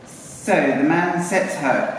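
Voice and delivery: usual speaking voice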